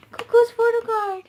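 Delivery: high, loud excited squeal; thrilled squeal. Real, not acted. Fan finding her favourite member's photo card, thrilled squeal. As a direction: squeal at the find.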